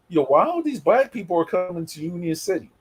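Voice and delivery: ordinary delivery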